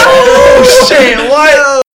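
very high, loud outburst; long, high holler of shock; shocked shout. Acted. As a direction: yell out in over-the-top disbelief. Shocked outburst at underage reveal.

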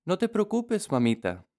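put-on high, bright child voice; little girl voice. A narrator's reading. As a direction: reassure mom like a child. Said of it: "dijo la niña". (voz de niña pequeña)

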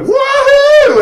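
high, loud whoop of joy; gleeful retold yell; joyful scream. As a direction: whoop like diving into cool water. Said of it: "runs down the beach screaming".

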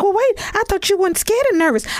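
high, quick teasing disbelief; mock incredulous friend. Imitating Travis teasing her about being scared. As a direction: throw her words back at her, way up high. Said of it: "He was like".

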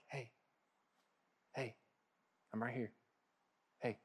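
put-on calm a child like a mother would; tender, quiet comfort; soothing mother. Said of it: "soothing, a mother and her child".

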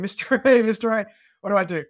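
retold call for help, high and loud. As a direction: call up to a mentor some distance away, reenacted. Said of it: "I kind of called out to him".

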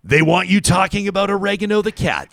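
high-pitched playful accusation; hammed-up suspicion, high voice; mock conspiracy rant. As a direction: pitch it high like an exposé of a silly plot. Mock conspiracy rant, contrasted wants.

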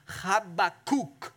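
Habakkuk slowly, syllable by syllable, ha-ba-KOOK; Habakkuk drawn out, final syllable "kook"; Hebrew pronunciation. Shown as a demonstration. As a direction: lead the room in saying Habakkuk the Hebrew way. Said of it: "Try it with me".

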